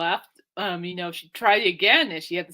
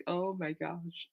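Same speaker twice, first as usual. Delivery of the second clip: hushed, lightly acted holding back; stifling a laugh; 7 dB quieter, slower than usual. To herself beside her sister, stifling a laugh. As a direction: say it softly, trying hard not to laugh. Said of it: "trying so hard not to laugh".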